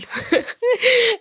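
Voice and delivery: quick high giggle; a bright little laugh at her own idea; short laugh. Real, not acted. Cooking vlogger laughing at her sandwich treat tip, high giggle.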